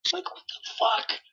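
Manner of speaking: high, slow, breathy what the fuck; stunned disbelief. Real, not acted. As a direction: gasp it out in disbelief. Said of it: "outrage at officer".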